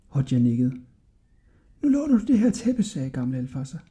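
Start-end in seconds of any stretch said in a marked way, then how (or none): 1.8-2.8 put-on high, hushed old man's voice; cautious old shopkeeper. A narrator's reading. El Fazer warning Hodja of eavesdroppers, hushed and high. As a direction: warn the boy confidentially, high and soft.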